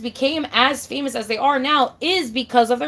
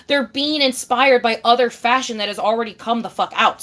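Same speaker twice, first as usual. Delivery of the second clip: ordinary delivery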